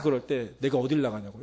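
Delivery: ordinary delivery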